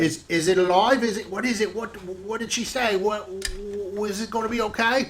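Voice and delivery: high, eager, tumbling questions; anxious father. Acted. Nervous father pleading with the midwife, high and anxious. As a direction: fret out loud about the newborn. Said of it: "and Eric says".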